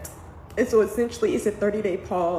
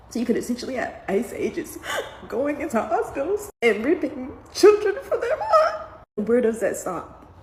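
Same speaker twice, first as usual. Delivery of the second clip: crying; 5 semitones higher than usual. Real, not acted. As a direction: cry through the words. Crying over children taken.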